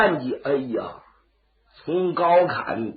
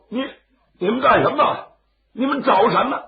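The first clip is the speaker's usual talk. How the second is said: put-on startled old man; 6 semitones higher than usual. Startled old man confronts intruders.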